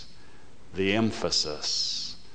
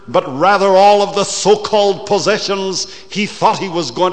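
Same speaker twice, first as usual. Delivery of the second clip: loud, higher and fast, rushing on; preaching at full force; 9 semitones higher, 10 dB louder, faster than usual. Acted. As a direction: pour it out like a fiery preacher. Preacher drives the point home.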